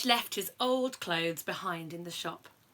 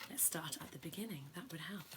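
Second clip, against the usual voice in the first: whisper; 6 semitones lower, 15 dB quieter, faster than usual. Real, not acted. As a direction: whisper it softly like a secret. Whispered aside to child.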